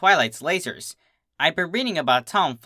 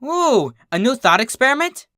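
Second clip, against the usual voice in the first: high, eager squeal of delight; bright-eyed excitement, pitched way up; thrilled gasp then eager question; excited; 10 semitones higher than usual. Acted. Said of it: "Twilight's eyes lit up".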